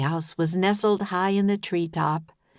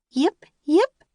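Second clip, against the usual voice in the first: very high, loud puppy yips; 10 semitones higher, 6 dB louder than usual. Acted. The puppy yipping to join them. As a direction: yip like a tiny dog.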